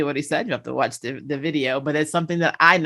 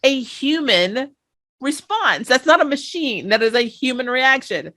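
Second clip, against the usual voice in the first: real delight, voice raised high; excited emphasis; 6 semitones higher than usual. Real, not acted. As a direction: insist it's human, still giddy. Emotional after laughing.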